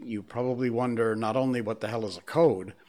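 usual speaking voice

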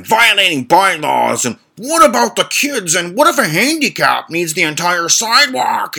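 high, mock ranting old man; hammed-up shrill rant; imitated screaming neighbor. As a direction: whine it like an angry neighbor. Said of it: "And he's just screaming".

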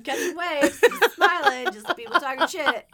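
high, loud words through laughter; cracking up mid-sentence; shrieking with laughter while riffing; laughing. Real, not acted.

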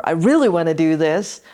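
loud, eager punch on really; keen enthusiasm. Shown as a demonstration. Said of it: "We are sending out".

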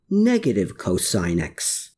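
ordinary delivery, nothing marked

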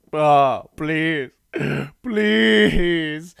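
hammed-up high pleading wail. Condemned man behind priest and guards, hammed-up pleading. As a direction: beg loudly, stretching out the mock plea. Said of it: "slowly walking a pleading man".

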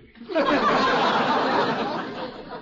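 a loud laugh with no voice in it; voiceless chuckle. Acted. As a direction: laugh out on the breath alone. Laughs at the Judge Hooker joke.

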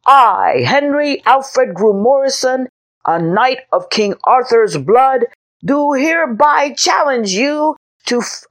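high, loud grand challenge; a six-year-old knight declaring battle; boyish knight's proclamation. A narrator's reading. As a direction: proclaim like a little boy playing knight. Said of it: "Henry announced himself loudly".

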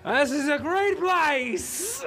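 high, very slow joyful proclamation; drunk mayor. Acted. Pickled mayor tosses his beer and praises the tavern, high and slow. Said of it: "tosses it down on the floor and goes".

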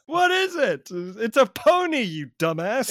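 high, loud mock wondering, then jeering; mocking exchange. Mocking kids who wonder about a broom-shaped bag, hammed-up. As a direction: play clueless, then mockingly set them straight. Acted mockery of clueless kids.

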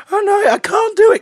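very high, loud panicked squeak; hammed-up frightened schoolboy; tremulous. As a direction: plead in a trembling squeaky voice. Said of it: "all tremulous again".

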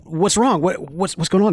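normal talking voice

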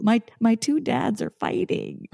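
put-on high, slow kid-like lament; mock distressed child. Child seeing two dads fighting, mock distressed.

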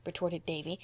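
ordinary delivery

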